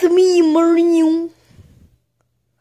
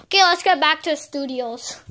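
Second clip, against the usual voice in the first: ordinary talk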